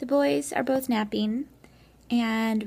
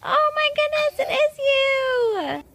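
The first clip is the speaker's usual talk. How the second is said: playful surprise; 6 dB louder, slower than usual. Pretend. Finding child in hide-and-seek.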